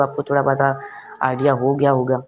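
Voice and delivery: usual speaking voice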